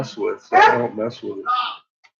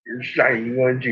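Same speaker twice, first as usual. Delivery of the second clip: ordinary talk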